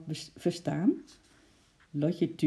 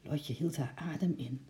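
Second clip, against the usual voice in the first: ordinary delivery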